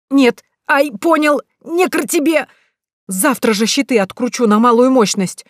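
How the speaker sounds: high pained yelps; yelping in pain. Acted. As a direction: yelp as if just zapped. Groom yelps as he is zapped.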